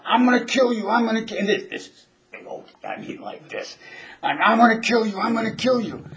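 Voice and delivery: high, pumped-up threat; hyped-up threat. Retold or imitated. Big Okie rushing up before the bout, high threatening yell. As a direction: psych him out with a high yell. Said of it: "and he's like".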